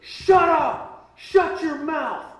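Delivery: loud order pitched far up; mock-furious high yell to shut someone up; angry US Marshal. US Marshal silencing a man in an elevator, angry high yell. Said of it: "looks over at me and goes".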